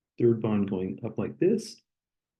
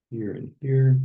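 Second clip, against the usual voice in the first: Here and here louder, each here punched; emphatic repeat; 3 semitones higher, 4 dB louder than usual. Shown as a demonstration. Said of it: "let me just emphasize these".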